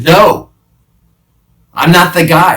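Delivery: high, loud panicked denial. Acted. Peter denies the guard's charge, loud and high. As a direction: shout it to push them away.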